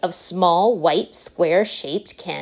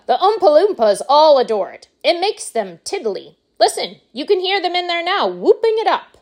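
high-pitched, gleeful showman voice; exuberant Wonka; 10 semitones higher, 4 dB louder than usual. Acted. Wonka boasts of his tipsy workers, high and exuberant. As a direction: revel in it, swooping up high.

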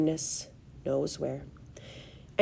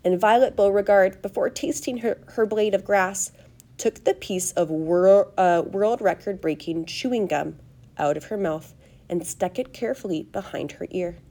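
ordinary delivery

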